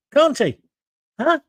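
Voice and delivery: normal talking voice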